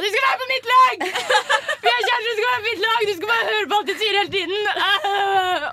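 high, loud reenacted drunken screaming. Drunk girlfriend demanding her boyfriend take her side, shrieked retold tantrum. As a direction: scream it like a tantrum, far up in pitch. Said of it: "så bare begynner jeg å skrike sånn".

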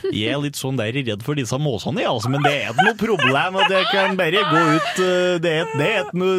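lilting Hedmark dialect imitation. Shown as a demonstration. Host demonstrating fear of seagulls, in comic Hedmark dialect. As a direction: put on a hammed-up Hedmark accent. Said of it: "uansett, Hedmark også".

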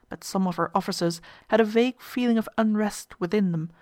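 ordinary delivery

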